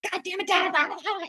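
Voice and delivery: high-pitched, held-back mock yell; mock harsh outburst. Daughter imagining losing it at her father on the phone, mock harsh. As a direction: imitate blowing up without real volume. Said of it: "you were a little harsh".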